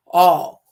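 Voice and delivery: "all" loud and alone; stress on ALL. Real, not acted. Speaker repeats it to make listeners wait on it, emphatic "all". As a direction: punch "all" hard as one word.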